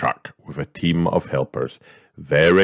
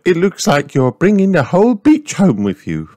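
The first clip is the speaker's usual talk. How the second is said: high, loud sighing rabbit lady; Miss Rabbit sighing; 7 dB louder than usual. A narrator's reading. Miss Rabbit sighs to Mummy Pig, high and loud. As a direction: sigh it out as the fussy rabbit. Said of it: "sighed Miss Rabbit".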